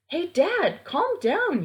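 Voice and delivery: high calling-out yell; mildly shouted plea to calm dad; boy yelling at wolf dad to calm down. Acted. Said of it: "yelled Sam in vain".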